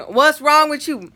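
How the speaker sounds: loud, high, slow mama demanding an answer; insistent mock-mama questioning. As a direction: press the child loudly, imitating mom.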